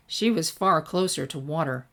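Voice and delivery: usual speaking voice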